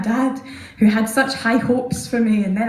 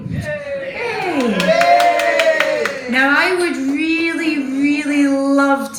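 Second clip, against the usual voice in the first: mock cheer; 5 semitones higher, much slower than usual.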